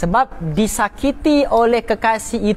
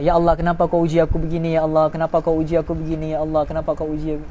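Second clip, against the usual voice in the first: lower, faster, fading softer as it repeats; quick lowered plea that trails off; complaining prayer; 8 semitones lower, 3 dB quieter, faster than usual. Retold or imitated. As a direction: mimic a servant questioning God's test, rushing. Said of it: "dia persoalkan takdir Allah".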